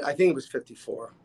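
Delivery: ordinary delivery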